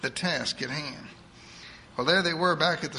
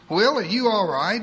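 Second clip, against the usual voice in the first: put-on higher, fast voice of a bossy old churchwoman; eager church lady; 5 semitones higher, 6 dB louder than usual. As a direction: rattle it off like a pushy saint. Edith worried for Will.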